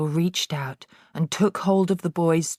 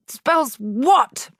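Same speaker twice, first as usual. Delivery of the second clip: higher, louder bark; demanding headmistress; 9 semitones higher, 6 dB louder than usual. A narrator's reading. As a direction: drill the answer in. Trunchbull demanding.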